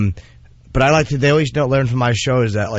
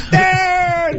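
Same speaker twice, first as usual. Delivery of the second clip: very high, drawn-out exclamation; hammed-up amazed bro; mock excited gossip. Comic acting out news about to come, mock excited.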